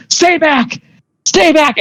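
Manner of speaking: high, urgent cries without real volume; high-pitched acted panic; panicked warding-off. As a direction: act cornered and keep the attacker away. Game master acts cornered woman warding off attacker.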